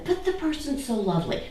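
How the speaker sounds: quick, high mock protest; mock objector. Teacher mimicking a doubter to students, high and quick. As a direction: toss off a doubter's sweet objection. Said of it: "somebody does".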